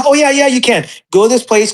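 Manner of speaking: retold easy dismissal, pitched high; breezy reassurance. Promoter brushing off a retold worry.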